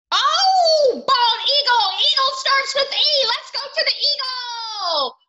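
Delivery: high, loud burst of excitement; excited discovery. Real, not acted. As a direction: cry out high and loud with delight. Excitedly spots the bald eagle.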